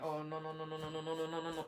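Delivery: soft, rapid string of noes; quiet dread under the breath; panicked murmur. Retold or imitated. Friend says it's not working, soft panicked noes.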